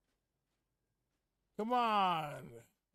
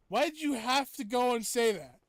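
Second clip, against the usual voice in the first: mock outrage; 8 semitones higher, 13 dB louder than usual. Mock outrage at a viewer's comment.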